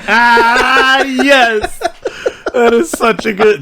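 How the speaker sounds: high, breathless laughter through the words; laughing hard. Real, not acted. Cohost reacting to the golf joke, laughing hard. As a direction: crack up at the punchline.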